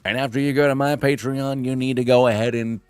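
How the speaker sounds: loud, hyped-up mock influencer pitch; hustling streamer parody. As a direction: oversell it like a pushy content creator. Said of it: "I try not to be like that".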